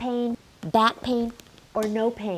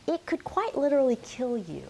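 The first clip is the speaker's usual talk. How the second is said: usual speaking voice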